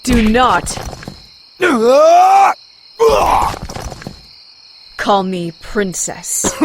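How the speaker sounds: a hard warning, don't push her; firm warning. Acted. Angry warning over being called princess.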